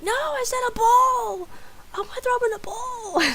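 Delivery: outraged yell. Retold or imitated. Sick roommate refusing the garbage can, outraged screaming. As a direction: holler the demand as the fed-up sick roommate.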